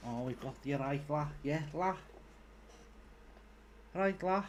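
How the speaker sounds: put-on Scouse accent, lightly done; hammed-up Scouse; mock Scouse. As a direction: play a Liverpool lad saying la. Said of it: "my terribly worrying Scouse accent".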